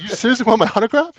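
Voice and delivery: laughing disbelief. Retold or imitated. Incredulous at autograph request.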